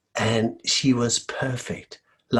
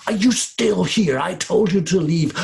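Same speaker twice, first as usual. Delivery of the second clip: high, loud furious scolding ending in a bark; angry; 7 semitones higher, 7 dB louder than usual. Acted. Lady of the house furious at the girl, ending in a bark. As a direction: scold her and bark at the end. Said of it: "she got angry and said".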